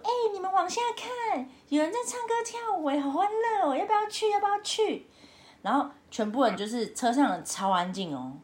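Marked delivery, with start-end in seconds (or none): 0.0-5.1 much higher, bright eager calling out; excited call to friends. Retold or imitated. Retelling calling friends in the car to look, excited high voice. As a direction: point excitedly down the hill, wanting to join. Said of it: "然后我就跟我朋友说".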